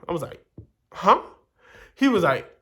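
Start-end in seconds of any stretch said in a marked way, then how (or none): 0.9-1.2 high, loud startled huh. Retold or imitated. Narrator startled by the boy's words, loud and high. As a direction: react as if you misheard something shocking.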